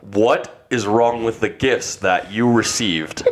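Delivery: slow, measured mentor-like dad; Professor X. Acted. Dad questioning ungrateful son, solemn professor voice. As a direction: question him slowly and formally. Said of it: "I pictured Professor X from X-Men".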